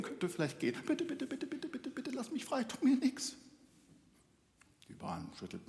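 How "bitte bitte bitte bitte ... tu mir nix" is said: high, soft begging bird; pleading bird voice. Acted. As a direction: beg in a tiny high bird voice.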